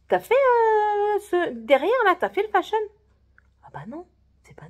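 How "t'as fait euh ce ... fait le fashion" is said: high, very slow, drawn-out disbelief; incredulous question. Retold or imitated. Asking the coworker if he did the fashion, drawn-out and high. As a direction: stretch it out, pointedly suspicious.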